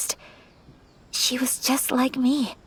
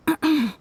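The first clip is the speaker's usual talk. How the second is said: nervous throat clearing; 5 semitones higher than usual. Acted. Nervous before asking Traveler.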